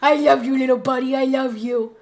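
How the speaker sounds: hammed-up imitation of the husband cooing over the dog; lightly voiced husband soothing the dog; doting husband. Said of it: "But Royce is like".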